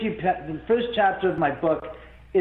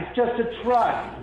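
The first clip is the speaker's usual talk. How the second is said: normal talking voice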